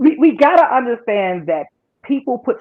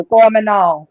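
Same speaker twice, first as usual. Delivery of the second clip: Miss Cleo; 5 dB louder than usual. Shown as a demonstration. Imitating a TV psychic for friends, fake Jamaican accent. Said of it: "like a Miss Cleo".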